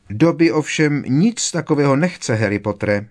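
high, loud elf voice; high-pitched, emphatic house-elf. A narrator's reading. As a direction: pipe up emphatically in a little elf voice. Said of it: "mluvil už zase jako jindy".

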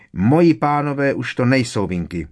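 defiant little elf voice. A narrator's reading. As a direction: talk back stubbornly. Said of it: "odsekl skřítek vzdorovitě".

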